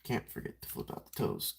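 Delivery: half-whispered to himself, very soft; a soft whisper, reminding himself; whispered reminder. Real, not acted. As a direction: murmur the reminder under your breath.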